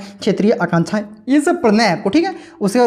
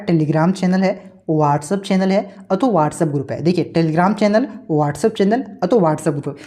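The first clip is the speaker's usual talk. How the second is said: slow, deliberate listing; slower than usual. Shown as a demonstration. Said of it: "repeating channel names for students".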